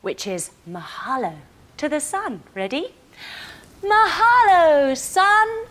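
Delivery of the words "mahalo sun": loud, slow, high call to the sky; joyful call. Pretend. Seals call thanks to the sun, loud and slow.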